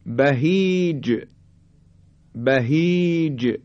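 "بهیج" drawn out, final ج bounced; qalqalah on final jim. Shown as a demonstration. Teacher demonstrating jim at word end, "بهیج" with bounced ج. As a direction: hold the long i of "بهیج", then release ج. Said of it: "حرف جیم".

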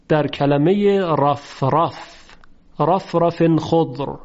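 رفرف خضر with both ra sounds thick and full; heavy ra recitation. Shown as a demonstration. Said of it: "هر دو مورد مفتوح است". (رفرف خضر با رای درشت و آهسته)

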